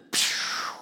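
mouthed whooshing blast; explosion noise. Retold or imitated. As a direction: blow out an explosion sound. Imitated explosion before war breaks out.

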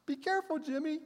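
high, soft motherly nag; sing-song worried mother; mock mom repeating her warning; imitating mom.

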